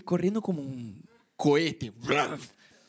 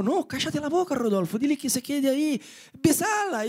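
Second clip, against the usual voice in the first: higher mock tempter voice; tempting inner voice; 7 semitones higher than usual. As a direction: egg yourself on to kiss her. (voz interior tentadora, más aguda)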